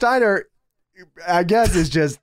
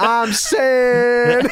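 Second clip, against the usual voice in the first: higher, laughing mock promo opener; Steiner catchphrase; 7 semitones higher, 3 dB louder than usual. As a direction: deliver the catchphrase like a wrestler. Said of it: "I love when he starts off like that".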